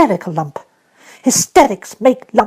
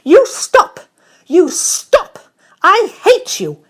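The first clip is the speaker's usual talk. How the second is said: high, loud screaming rage; a girl's furious tirade; furious little voice; 13 semitones higher, 7 dB louder than usual. Acted. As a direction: scream him down in fury. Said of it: "the furious little voice".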